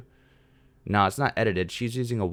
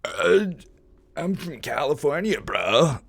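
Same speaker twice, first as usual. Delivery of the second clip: put-on higher, louder California surfer-bro accent; 8 semitones higher, 7 dB louder, slower than usual. Streamer imagines Californians mocked like New Jersey, California bro accent. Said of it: "if California had an iconic accent".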